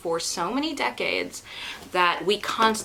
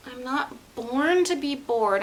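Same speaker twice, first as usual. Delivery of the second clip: ordinary talk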